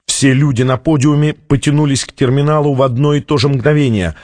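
ordinary delivery

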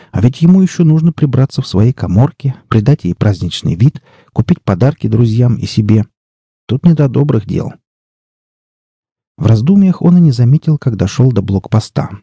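ordinary delivery

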